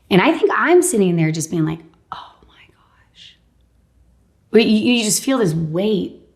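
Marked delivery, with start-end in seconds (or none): 2.1-3.4 slow, breathless whisper; stunned whisper. Retold or imitated. Patient reeling from the diagnosis, whispering slowly.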